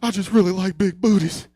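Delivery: high, loud whimpering confession; mock tearful. Little brother caught, apologizing with tears in his eyes, mock tearful. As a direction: wail it like a caught kid, hammed-up. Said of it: "he's got tears in his eyes".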